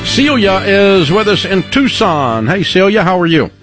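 ordinary delivery

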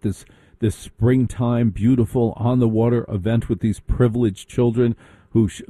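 ordinary talk, nothing marked

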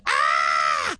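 short storyteller's shriek; mock scream of fright; acted scream. A narrator's reading. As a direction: let out a startled scream. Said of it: "And Aunt Edith screamed".

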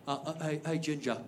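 soft, low, halting question; hushed British heckler. Retold or imitated. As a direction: hesitate in quiet British disbelief. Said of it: "the same guy pipes up and he goes".

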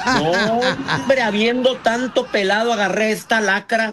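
high, laughing exasperation; mock lament. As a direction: lament your choice of partner for laughs. Acted lament about a yelling partner. (lamento burlón y exasperado)

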